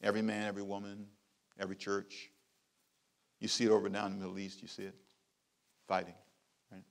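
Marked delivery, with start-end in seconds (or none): none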